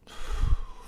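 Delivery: heavy blown-out sigh; emotional sigh. Real, not acted. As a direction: let out a long breath, getting emotional. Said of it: "I'm getting emotional again".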